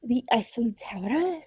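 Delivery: mock French accent on The Eiffel Tower. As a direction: say it like a hammed-up Parisian. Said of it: "say these in your best French accent".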